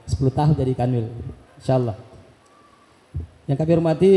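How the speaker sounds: ordinary talk, nothing marked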